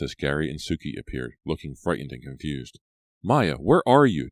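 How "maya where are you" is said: louder, higher call into the woods. A narrator's reading. Della calling for Maya across the campsite, louder and higher. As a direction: call for her friend across the camp. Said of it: "Della called".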